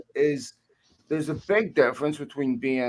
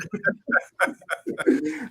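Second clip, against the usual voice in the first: laughter; 13 semitones higher than usual. Real, not acted. Laughing at own impression.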